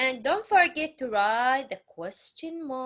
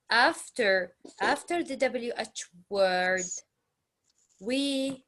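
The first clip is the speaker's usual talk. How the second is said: usual speaking voice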